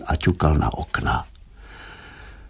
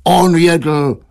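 slow, higher exasperated whine; drawn-out pleading protest; wailing husband; 5 semitones higher, slower than usual. A narrator's reading. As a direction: whine for some peace, dragging the words. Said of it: "zakvílel obchodník".

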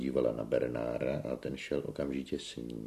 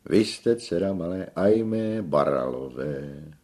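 theatrical tenor; 4 semitones higher, 7 dB louder than usual. A narrator's reading. Tenor grandly identifies the girl.

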